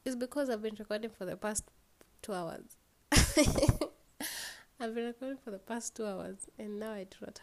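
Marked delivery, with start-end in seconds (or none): 3.1-3.9 loud high burst of laughter; a quick rueful laugh; short laugh. Real, not acted. About recording for hours with nothing, loud laugh.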